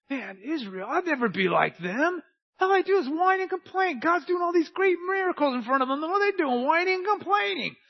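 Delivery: high, loud mock whine of a smug churchgoer; mocking whiny voice. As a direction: ham up a smug guy who whines while blaming Israel. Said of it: "coming up to me and going".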